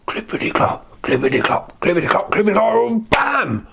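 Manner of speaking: hoofbeats rising to high impact; imitated charging hooves. Goat charges the troll, rising hoofbeat imitation. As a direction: build pitch up to the crash.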